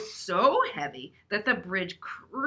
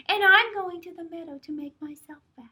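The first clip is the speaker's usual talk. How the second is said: tiny goat voice, much higher; very small voice; 9 semitones higher, 4 dB louder than usual. Acted. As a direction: squeak it out like the littlest goat. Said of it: "in his very small voice".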